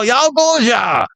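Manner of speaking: high, angry mock-Chinese shout; hammed-up angry Chinese cry. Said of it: "in an angry Chinese voice".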